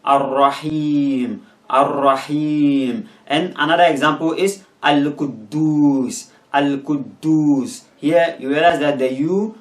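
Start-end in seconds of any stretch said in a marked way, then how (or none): 0.0-3.1 "Ar-Rahim" slowly with a long held ee; teacher's slow "Ar-Rahim", last syllable drawn out; prolonged E. Shown as a demonstration. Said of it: "that part should be prolonged". 4.8-7.8 "Al-Quddus" slowly with a long held oo; teacher's slow "Al-Quddus", final syllable drawn out; prolonged U. Shown as a demonstration. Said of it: "that part should be prolonged".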